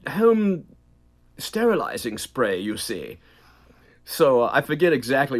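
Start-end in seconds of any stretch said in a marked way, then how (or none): 0.0-3.2 smarmy British voice. Shown as a demonstration. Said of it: "it was talking like this".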